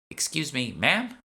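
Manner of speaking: put-on high, polite young woman's voice; a young shopper butting in; young woman's interruption. Lisa interrupting at the counter, high and polite.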